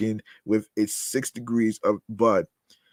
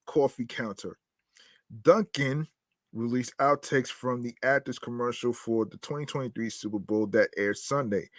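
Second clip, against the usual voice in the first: usual speaking voice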